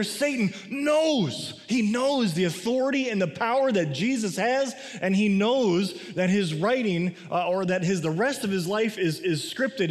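ordinary delivery

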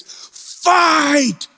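high desperate yells, each word hurled; desperate screaming. Retold or imitated. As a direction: scream at her to fight for her life. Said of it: "starts screaming in her face".

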